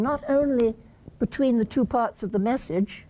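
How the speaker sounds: ordinary talk, nothing marked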